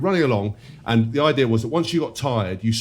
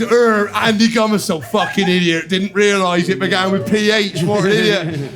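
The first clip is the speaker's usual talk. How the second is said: slow, loud, higher mocking teammate voice with a laugh; imitating Ronnie; 5 semitones higher, 4 dB louder than usual. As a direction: ham up his telling of the story. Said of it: "And he's".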